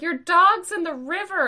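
high, loud alarmed call; bystanders shouting. Retold or imitated. Strangers alerting her that the dog's in the river, high loud shout. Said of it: "they're like".

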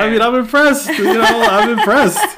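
high laughing mock concession. Real, not acted. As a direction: laugh out a grudging admission.

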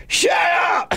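high-pitched imitated shout cutting off the sad act; mock shout. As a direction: mimic a sudden yell, pitch leaping up. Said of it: "They supposed to be all sad".

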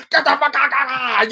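loud, high retold scream. Man screaming at the dog that bullied into the house. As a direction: yell it frantically, pitch jumping up. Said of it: "I was screaming at the dog".

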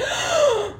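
dramatic gasp. Retold or imitated. Imitating coworker gasp.

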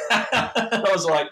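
loud laugh breaking into speech. Real, not acted. Telling how the stranger blocked him, laughing out loud.

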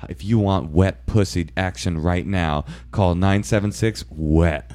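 slow, drawn-out hotline recording; lingering phone-line sales pitch; phone-sex info line. Retold or imitated. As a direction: imitate a sultry teaser message. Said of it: "the, the info line".

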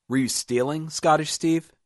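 high, loud accusing question. A narrator's reading. Roop questions the wounded man about stealing, accusing.

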